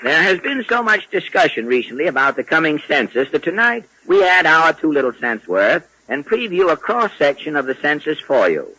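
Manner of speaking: ordinary talk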